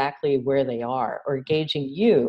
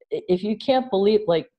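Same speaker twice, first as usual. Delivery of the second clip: ordinary delivery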